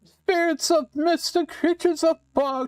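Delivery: witch voice. Acted. Witch cursing the player into a frog, high and gleeful. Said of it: "I read it in her voice".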